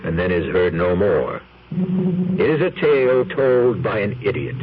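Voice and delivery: ordinary talk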